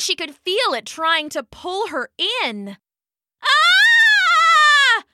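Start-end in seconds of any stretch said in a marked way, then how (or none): 3.4-5.0 long, high-pitched scream; loud sustained shriek of fright. A narrator's reading. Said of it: "she shouted".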